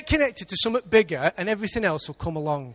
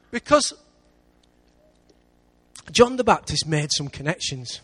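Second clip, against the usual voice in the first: usual speaking voice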